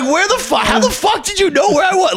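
high pitched disbelieving shout; shocked. Retold or imitated. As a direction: squeal it in disbelief. Shocked to see mom.

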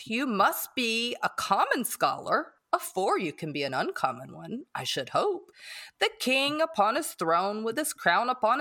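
simple, kind man with swinging intonation; Joe. Acted. Podcast host reads Joe's advice to Pip, in a folksy character voice. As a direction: play a homespun, earnest fellow, pitch rising and dipping. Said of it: "in my best Joe voice".

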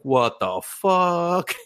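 very slow, high-pitched disbelief; astonished cat. Retold or imitated. Cat watches him move a building, slow high astonishment. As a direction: stretch each word out, stunned. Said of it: "And the cat's like".

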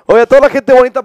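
big hosty greeting; loud call-out. Acted. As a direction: hail the whole audience at full voice. Calls out to the audience.

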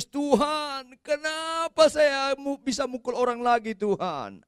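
high, drawn-out mock wail; tearful cry to God. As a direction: imitate a preacher's anguished plea to God. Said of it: "tapi saya nangis".

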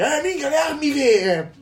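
put-on high, slow kid amazement; excited kid voice. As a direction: gasp it like an awed child. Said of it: "G T A cinque dice". (voce acuta da bambino meravigliato)

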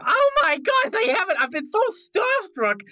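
high, gushing fan voice; starstruck Lil Stragglers. Acted. Starstruck kid meeting an idol, high and excited. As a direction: gush in a high character voice.

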